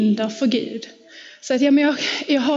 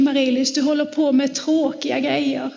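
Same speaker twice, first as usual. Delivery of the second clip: ordinary delivery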